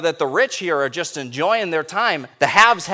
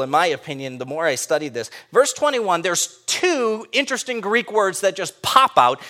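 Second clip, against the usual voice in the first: ordinary delivery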